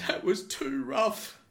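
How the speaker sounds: put-on high, slow whiny sob voice of a drunk; mock a crying man-child. To the bouncer, the drunk whines like a child, high and slow. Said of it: "he started crying".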